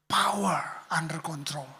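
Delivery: slow whispered "Power under control.". Shown as a demonstration. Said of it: "lemah lembut ini". ("Power under control." dibisikkan pelan)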